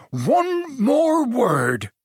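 slow, high, shouted threat; furious shout. A narrator's reading. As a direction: roar the last warning. Hagrid threatens Vernon.

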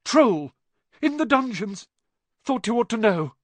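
high, loud breathless alarm; gasped panic. A narrator's reading. Quirrell bursting in to warn Dumbledore, panicked gasps. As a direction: blurt it out in terror. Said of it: "and gasped".

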